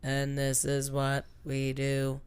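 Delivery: low, slow monotone drone; deadpan, no melody at all. Pretend. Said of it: "I'll put my best monotone voice on".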